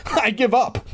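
normal talking voice

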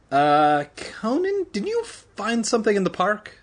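very high, hesitant voice; timid question. A narrator's reading. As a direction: squeak out a tentative question. Evan off balance, timidly asking Conan.

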